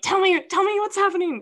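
high, loud pleading demand; retold plea, pitched right up and insistent; frantic demand. Retelling texts begging a friend for answers, frantic.

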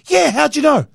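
high, eager reply; bursting to answer him; eager fan. Retold or imitated. Young fan answers the actor, high and eager.

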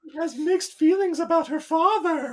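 slow, high, knowing therapist voice; Deanna Troi voice. Acted. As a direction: draw it out like a TV empath. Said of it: "slipped into Deanna Troi voice".

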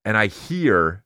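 normal talking voice

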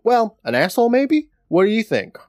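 high-pitched, mock-innocent comeback; flippant retort. Acting Marty barely paying attention to Carl, flippantly. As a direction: toss it back high and cheeky.

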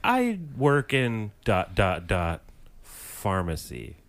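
drawn-out pauses before the cover story; evasive hesitation. Acted. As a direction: pick the words slowly, as if covering something up. Acts out an evasive drug dealer.

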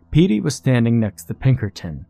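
plain reading voice

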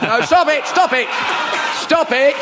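high exclaimed protest; mock scolding. Comic telling the laughing audience off, mock outrage.

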